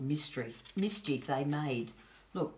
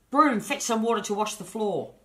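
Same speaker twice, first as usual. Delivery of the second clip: higher and louder spell-casting order; boy playing sorcerer ordering the broom; grand command; 6 semitones higher, 7 dB louder than usual.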